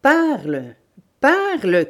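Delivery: loud, forceful command, rising in pitch; shouted order. Acted. Bandit torturing the old woman to make her talk, shouting. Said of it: "cria-t-il".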